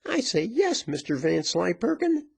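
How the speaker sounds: high, menacing insistence; high-pitched commanding outcry; virago's angry cry. A narrator's reading. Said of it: "cried the virago, looking daggers".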